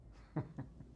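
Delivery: soft, breathy run of chuckles; private chuckle. Acted. Old guy hinting at with whom, private chuckle. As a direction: laugh quietly to yourself.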